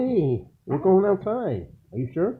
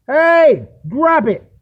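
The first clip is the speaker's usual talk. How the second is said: loud high shout at the dog; yelling; 12 semitones higher, 10 dB louder, much slower than usual. Real, not acted. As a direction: bellow to make her drop it. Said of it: "Sorry about yelling".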